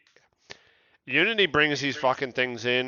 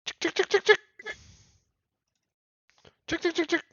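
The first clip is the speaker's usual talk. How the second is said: high chick call; 14 semitones higher, faster than usual. Acted. Calling the chicks in a game.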